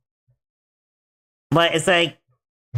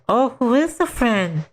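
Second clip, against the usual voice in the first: higher, light questioning tone; higher mom voice asking about the friend; sweet mom voice; 8 semitones higher than usual. Retold or imitated. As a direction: play the curious mom. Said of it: "I was like".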